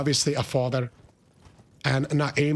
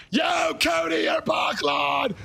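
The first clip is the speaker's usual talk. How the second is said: much higher, loud shouted hello; excited greeting; 13 semitones higher, 3 dB louder, slower than usual. Real, not acted. Streamer welcoming Cody back, excited shout. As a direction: shout a joyful welcome to a friend.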